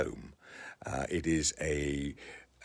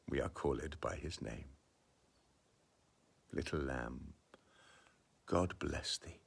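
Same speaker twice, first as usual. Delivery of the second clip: poem reading; 5 dB quieter than usual. Acted. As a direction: savour each line of the poem gently. Reads Blake poem aloud.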